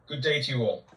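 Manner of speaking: normal talking voice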